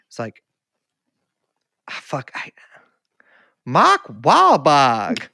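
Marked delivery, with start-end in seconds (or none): 3.7-5.3 long drawn-out Carolina vowels, r's dropped; Carolina accent. Retold or imitated. Quoting an overheard moviegoer, in a slow Carolina accent. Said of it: "in a Carolina accent".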